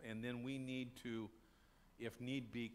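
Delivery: normal talking voice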